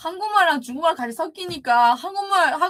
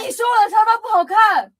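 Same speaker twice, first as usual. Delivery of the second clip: high, loud playful complaint; teasing protest; 9 semitones higher, 5 dB louder than usual. Real, not acted. Playful argument over sofa.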